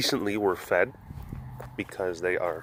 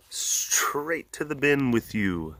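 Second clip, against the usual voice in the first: slow dismissal; 3 semitones higher, 4 dB quieter, slower than usual. Real, not acted. Repeat slower.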